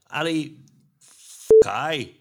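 faltering Scottish accent that slips into Northern Irish; Scottish accent attempt. Shown as a demonstration. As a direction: another shaky go at a Scottish accent. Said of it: "No, that's still Northern Ireland".

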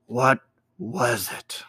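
slow, fearful whisper; dry with fear. A narrator's reading. Ezra asks after the vision vanishes, dry fearful whisper. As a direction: barely voice it, awestruck and frightened. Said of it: "in a voice dry with fear".

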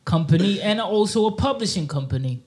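lightly put-on London street accent; london roadman accent. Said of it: "Me, I'ma use this, what's this guy".

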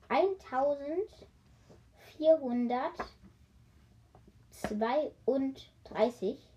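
drawn out while counting; slowly reckoning the number aloud; slow calculating. Real, not acted. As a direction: stretch the number out as you work it out.

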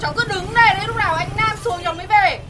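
put-on loud, high calling out to a boy upstairs; shouting up to him. Girl calling up to Nam's house, loud shouting. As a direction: yell it up at the window, lovesick.

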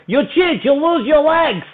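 high, indignant mock Russian accent. Beaten Russian gets off him complaining, high mock Russian accent. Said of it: "that is a pristine Russian accent".

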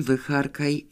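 ordinary delivery, nothing marked

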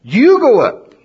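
high, loud mock panic; scared people. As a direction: beg Moses to go instead. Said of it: "And they said".

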